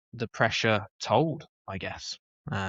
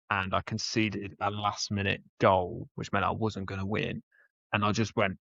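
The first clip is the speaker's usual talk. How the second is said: ordinary delivery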